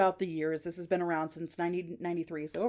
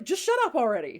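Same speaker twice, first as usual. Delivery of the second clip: mock exasperated yell, higher and louder; fed-up mom; 6 semitones higher, 5 dB louder, faster than usual. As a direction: imitate a mother snapping at a bickering husband. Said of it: "gets very worked up, and the mom goes".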